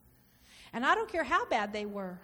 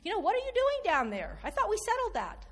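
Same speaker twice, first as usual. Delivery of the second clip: higher, puzzled, fatherly question; God's gentle rebuke; 7 semitones higher than usual. Acted. God asking the believer why they went back down, puzzled. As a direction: ask it lifted, like a patient father. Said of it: "and God's going".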